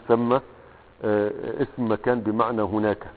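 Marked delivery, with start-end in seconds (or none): 0.1-0.3 "ثم" pronounced thamma, with an a; fatha on tha. Shown as a demonstration. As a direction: open the first vowel of "ثم": thamma. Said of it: "بفتح الثاء". ("ثم" بفتح الثاء)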